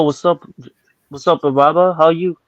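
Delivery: normal talking voice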